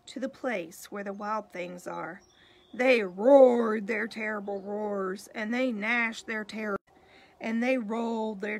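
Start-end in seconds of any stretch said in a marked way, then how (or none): 2.7-5.3 louder, roaring read. A narrator's reading. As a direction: let the roar swell. Reading the monsters' roar.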